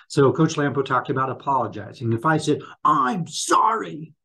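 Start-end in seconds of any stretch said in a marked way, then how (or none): 2.8-4.1 slow, higher, drawn-out fake apology; insincere sorry. Shown as a demonstration. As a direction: say sorry without meaning it. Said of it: "you think that person's really sorry? No".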